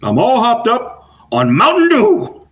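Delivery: high, slow, silly hopped-up voice; mock hyped up. Friends joking about energy drinks, high silly drawl. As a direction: drag it out high like you're buzzing on soda.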